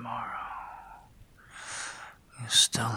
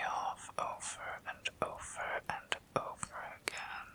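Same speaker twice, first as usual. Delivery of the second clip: right in your ear; 5 semitones higher than usual. Acted. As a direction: close whisper in the ear. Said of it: "having my voice in your ear".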